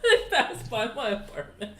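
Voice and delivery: real laughter breaking up the words; cracking up mid-sentence; laughing. Real, not acted. Amused by honking outside mid-game.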